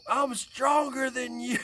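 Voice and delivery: high, drawn-out showy boast; hammed-up taunt. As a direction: proclaim it slowly to embarrass her. Dad's loud closing taunt to daughter.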